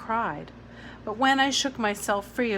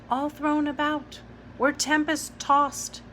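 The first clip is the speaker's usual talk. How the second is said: excited; 7 semitones higher than usual. Acted. Child excited by new word.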